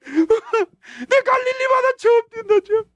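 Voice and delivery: high, loud, slow joyful cry through laughter; laughing and crying. Retold or imitated. Healed man running by the sea, laughing through sobs. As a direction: laugh through tears of joy.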